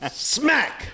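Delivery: very high, sudden mock smack; shouted sound effect. Storyteller says the slap instead of hitting, high shout. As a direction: shriek it as a pretend slap. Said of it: "I say that. I go".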